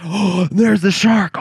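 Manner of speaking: gasp, then high, slow exclamation; mock startled gasp. Audience member seeing the shark appear, mock gasping alarm. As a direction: imitate a moviegoer suddenly shocked.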